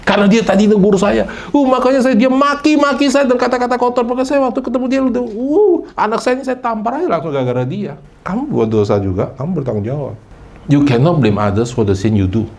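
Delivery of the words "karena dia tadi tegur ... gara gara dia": much higher, whiny, blaming someone else; mocking excuse voice. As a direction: play the self-justifying sinner, pitched way up. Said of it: "Itu bahasa Indonesianya". (suara merengek penuh dalih, jauh lebih tinggi)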